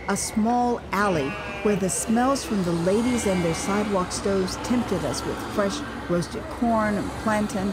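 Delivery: ordinary talk, nothing marked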